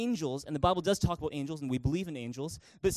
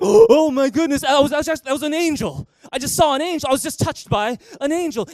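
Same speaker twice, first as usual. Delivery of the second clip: shocked, much louder and higher, breathless wonder; awestruck gasp; 7 semitones higher, 10 dB louder than usual. Retold or imitated. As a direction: blurt it out as if you just saw an angel.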